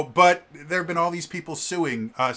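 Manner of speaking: ordinary talk, nothing marked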